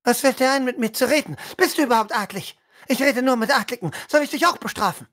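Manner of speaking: hohe, schnelle herablassende Lady; hochmütige Adlige. Acted. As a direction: mustere die Fremde verächtlich. Spielleiter spielt Lady Lilla. (Lady Lilla verhört Bronwyn hochmütig)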